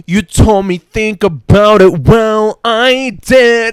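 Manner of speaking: high, loud sinister snowman taunt; evil snowman voice. Retold or imitated. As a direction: gloat like a trapped evil snowman. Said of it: "this evil snowman ... going".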